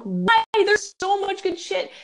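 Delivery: high, drawn-out frustrated cry; worked-up, high-pitched protest; exasperated outburst. Retold or imitated. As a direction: plead in exasperation, stretching the words. Ranting about exclusion from lists.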